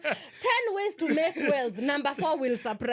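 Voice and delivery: teasing clickbait hype, half-laughing; mock a clickbait headline, chuckling; clickbait video narrator. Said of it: "You sound like those that".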